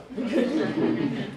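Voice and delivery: a short high giggle that breaks the reading; high breathy giggle. Real, not acted. Narrator cracking up at his own line, high giggle. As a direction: laugh at the line, high and airy.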